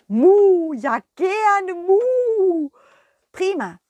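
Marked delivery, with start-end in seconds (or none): 0.1-2.7 high, loud, slow mooing cow; eager cow. Acted. The cow Kurita happily agreeing to tug of war. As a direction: moo your yes with delight.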